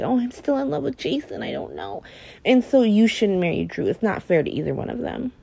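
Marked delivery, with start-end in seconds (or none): 0.0-2.0 soft lovesick mumble trailing into a whisper; mock lovesick Sam. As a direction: imitate her dithering, fading away. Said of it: "You're like".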